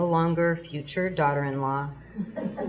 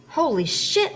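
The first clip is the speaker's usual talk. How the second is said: shocked; 9 semitones higher than usual. Acted. Shocked outburst at video.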